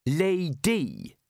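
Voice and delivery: each syllable laid down with care; careful and deliberate. A narrator's reading. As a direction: place the word like a winning card. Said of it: "uttered the word carefully and with deliberation".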